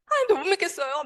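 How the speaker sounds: high-pitched protest, mildly plaintive; retold resentful plea. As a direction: relive the reproach with pitch well up. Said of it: "제가 막 그렇게 원망을 쏟아댔어요".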